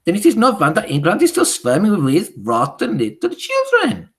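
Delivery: loud, high scornful witch voice; Grand High Witch thundering her contempt. Acted.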